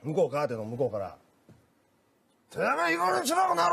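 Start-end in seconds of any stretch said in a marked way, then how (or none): 2.5-3.7 high mock angry yell at the masseuse. Customer yelling through the curtain, mock angry. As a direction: bark it like a furious old man. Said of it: "おっちゃんの 怒号が聞こえたと".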